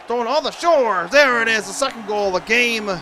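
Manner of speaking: loud, high excited goal call. Real, not acted. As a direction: erupt as the puck goes in. Goal scored during play-by-play.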